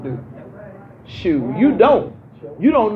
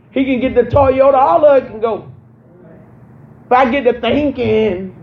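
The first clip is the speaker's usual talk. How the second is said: raised, fervent preacher climbing higher and louder; preaching at full force; 6 semitones higher, 5 dB louder than usual. Acted. Pastor preaching humility to her congregation, full force. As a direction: drive it home to the church, loud and high.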